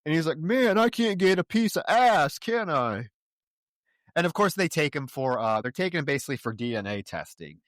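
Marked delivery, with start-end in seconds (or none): 0.4-3.1 slow, high, loud whine of complaint; hammed-up frustration, easing at the end; mock exasperated. Podcaster as Gamble interrupted by officers, mock exasperated.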